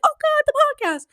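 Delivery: high and fast wail of dread; hurried dream panic. Retold or imitated. Recalling the dream again, a fast high squeal. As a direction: blurt it in a frantic squeal. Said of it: "and it's like".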